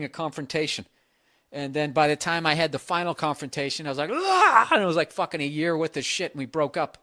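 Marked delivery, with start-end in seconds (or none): 4.1-4.7 mock outburst yell. Blowing up in final confrontation.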